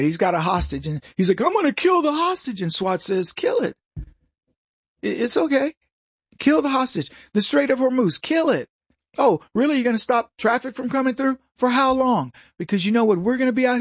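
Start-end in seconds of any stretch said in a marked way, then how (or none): 1.4-2.6 high-pitched hostage taker's threat; mock threat. 3.3-12.3 high-pitched dismissive dare; mock nonchalance. Acting an unimpressed SWAT team.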